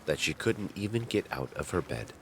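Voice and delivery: ordinary talk, nothing marked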